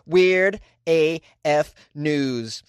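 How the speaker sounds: slow announcer call; drawn-out show name. Shown as a demonstration. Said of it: "Say it with me".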